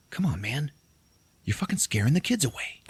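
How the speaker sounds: acted whisper into someone's ear; hushed, urgent stage whisper; whispered aside. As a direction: drop the act and whisper a warning. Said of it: "that Santa whispers into his ear".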